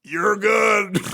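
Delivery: high, loud laughing reassurance. Retold or imitated. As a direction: shout it out, amused. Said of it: "And I'm like".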